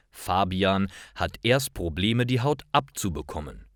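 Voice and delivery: ordinary delivery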